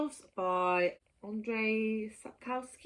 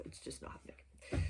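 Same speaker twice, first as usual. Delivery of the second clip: much softer, whispered give-up; resigned whisper; 10 semitones lower, 12 dB quieter than usual. Real, not acted. To viewers, giving up on the translated book, soft whisper. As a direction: let it fade to a defeated whisper.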